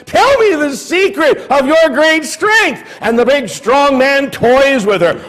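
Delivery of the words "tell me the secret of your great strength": higher pitched wheedling plea; whining nag. Acted. Delilah pestering Samson for his secret, whining. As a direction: coax and whine like a nagging lover. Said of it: "she nags and cajoles and whines".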